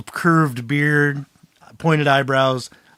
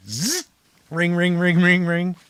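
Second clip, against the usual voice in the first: phone ring; 4 semitones higher than usual. Retold or imitated. Imitating an old phone ringing.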